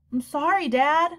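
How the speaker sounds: lightly voiced young boy, apologetic; a hint of a sorry kid; boy's voice. A narrator's reading. Son apologizing to his dad, lightly voiced boy.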